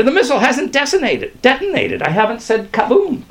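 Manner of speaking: high, hurried protest; high, insistent appeal; pleading. A narrator's reading. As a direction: protest urgently, pitched high. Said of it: "pleaded Skinner".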